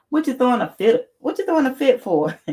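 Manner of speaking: high, incredulous teasing question; mock indignant. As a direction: playfully scold the chatter for a fake fit. Teasing a chatter's fake tantrum.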